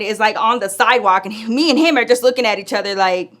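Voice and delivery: normal talking voice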